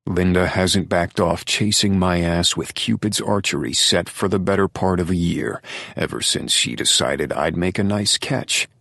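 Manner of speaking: usual speaking voice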